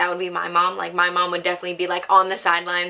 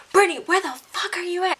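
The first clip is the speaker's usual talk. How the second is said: retold scream; 10 semitones higher than usual. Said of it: "She's screaming at me through the phone".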